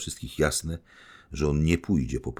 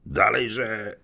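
higher, louder roar; 7 semitones higher, 5 dB louder than usual. Acted. Fierdyszczenko roaring at Gania, loud and high. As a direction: bellow it to spur him. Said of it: "ryknął Fierdyszczenko".

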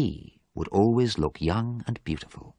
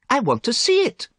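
high, loud insistence; acted stubborn protest; insistent; 5 dB louder than usual. A narrator's reading. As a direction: demand it, pushing back. Basil insists against refusal.

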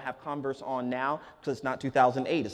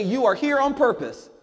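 "You are here on purpose." called out higher and louder; better Fresno State voice; 8 semitones higher, 5 dB louder than usual. Shown as a demonstration. Speaker leads the audience in a louder, higher chant. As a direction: pump up "here" like a rally cry. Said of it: "in a better Fresno State voice".

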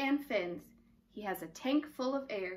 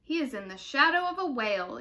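ordinary delivery, nothing marked

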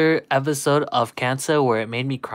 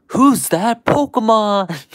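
high, slow announcer call; imitating the show announcer; 7 semitones higher, 5 dB louder, slower than usual. Streamer echoing the cartoon's catchphrase, high and slow. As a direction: belt it out like the TV show's announcer.

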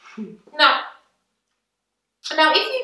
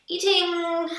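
ordinary delivery

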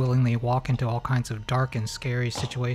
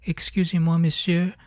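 a put-on French accent, asking politely and a bit higher; mock French; 6 semitones higher than usual. Mocking the girl who walks up to the possessed man, in a French accent. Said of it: "are all like".

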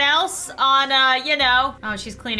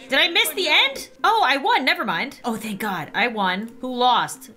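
ordinary talk

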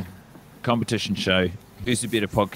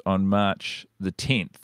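normal talking voice

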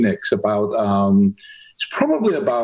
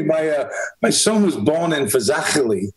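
ordinary talk

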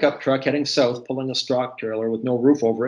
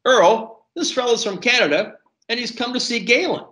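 high-pitched call to someone across the room; 11 semitones higher than usual. A narrator's reading. Waitress to cook across the open kitchen, calling out high. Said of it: "she called to the cook".

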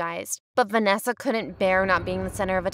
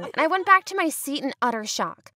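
normal talking voice

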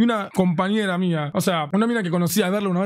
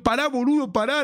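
urgent protest; 8 semitones higher than usual. Retold or imitated. Acting out calming his shouting friend.

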